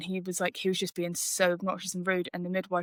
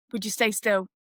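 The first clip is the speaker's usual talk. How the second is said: impatient doctor; 2 semitones higher than usual. Retold or imitated. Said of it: "rolled his eyes at me".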